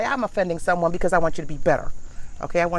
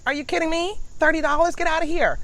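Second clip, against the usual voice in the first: higher, mock-scandalised disbelief; incredulous outrage; 8 semitones higher, 3 dB louder than usual. Real, not acted. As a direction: scoff at the price. Outraged at vegetable prices.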